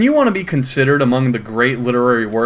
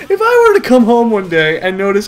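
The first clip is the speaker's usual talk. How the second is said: laughing while talking; 6 semitones higher than usual. Real, not acted.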